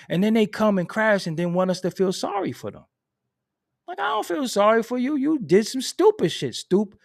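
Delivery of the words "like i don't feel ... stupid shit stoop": much higher, scornful; telling the reckless off, high and scornful; scornful rant. Real, not acted. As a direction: sneer at them for crashing. Rant at people who crash.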